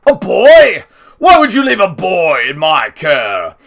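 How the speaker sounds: a shocked noble raising his voice at the news; indignant outburst. A narrator's reading. Grandpa scolding the little man, loud and indignant.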